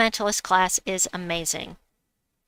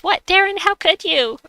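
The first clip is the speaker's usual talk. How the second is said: high, loud mock outrage; mock betrayal; 11 semitones higher, 7 dB louder than usual. Streamer reading a chatter's mock outrage at Darren, high and loud. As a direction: gasp it out like a scandalized friend. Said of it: "Isabel says".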